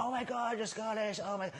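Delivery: mock American gushing. Americans hearing he's Scottish, mock gushing. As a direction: imitate thrilled strangers shrieking with delight. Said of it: "People are going crazy for Scotland".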